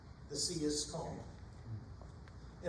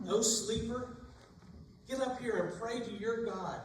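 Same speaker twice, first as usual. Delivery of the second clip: urgent call; 5 semitones higher than usual. Acted. Sailors waking Jonah in panic.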